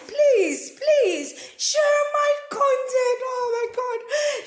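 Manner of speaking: high, loud, drawn-out wail; mock crying plea. Mother begging viewers for shares, hammed-up tearful wail. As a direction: beg as if bursting into tears. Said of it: "Don't think I'm crying".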